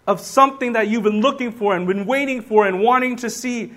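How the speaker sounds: loud, emphatic preaching, every line pressed home; full-force preaching. Acted. Preacher proclaiming Paul's joy to the congregation, loud and emphatic. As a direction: preach it like it's fact.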